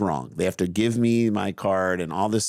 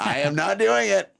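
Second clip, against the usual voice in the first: higher, insistent imitated refusal, every word spelled out; defiant refusal; 13 semitones higher than usual. Daughter refusing the tournament again, pitched up and defiant. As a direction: mimic a kid digging her heels in, pitched up. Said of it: "She's like".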